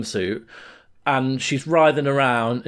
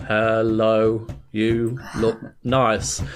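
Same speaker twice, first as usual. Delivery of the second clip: low, drawn-out, word by word; labored slow monotone, pausing between words; dead slow typing; 5 semitones lower, much slower than usual. Retold or imitated. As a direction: say it as if typing very slowly. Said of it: "So you type dead slowly".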